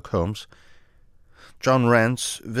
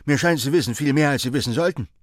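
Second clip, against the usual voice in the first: mit erhobener Stimme misstrauisch; argwöhnisch; 6 semitones higher, faster than usual. A narrator's reading. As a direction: den anderen voller Argwohn zur Rede stellen. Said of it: "Argwohn in den Augen". (argwöhnischer, höherer Tonfall)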